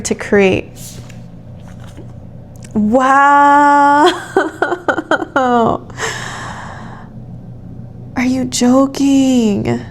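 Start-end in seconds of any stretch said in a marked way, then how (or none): 2.7-9.8 overwhelmed and moved to tears, slowly; tearful amazement. Real, not acted. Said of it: "The tears, they won't stop".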